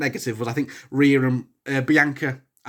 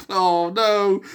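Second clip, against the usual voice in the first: normal talking voice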